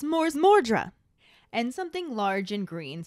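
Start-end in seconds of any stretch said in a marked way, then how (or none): 0.0-0.9 higher, louder mock yell; yelled spell. A narrator's reading. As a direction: yell the incantation from out of the darkness. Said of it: "a voice yells".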